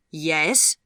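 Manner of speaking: a sharp, loud, impatient Yes?; sharply. A narrator's reading. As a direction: snap the question out. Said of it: "said the woman sharply".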